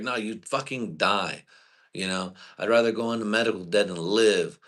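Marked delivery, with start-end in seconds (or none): none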